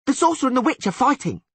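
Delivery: high, fast breathless shout; excited yell. Acted. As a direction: blurt it out to everyone. Urchin shouting the news.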